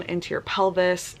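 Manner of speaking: usual speaking voice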